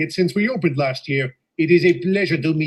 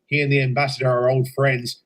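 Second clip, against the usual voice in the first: usual speaking voice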